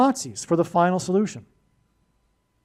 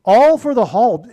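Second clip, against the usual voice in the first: "All" punched loud and high, "Hall" stressed after it; raised, forceful voice from "All" through to "Hall"; strong emphasis; 4 semitones higher, 9 dB louder than usual. Real, not acted. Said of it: "I say that with emphasis in my voice".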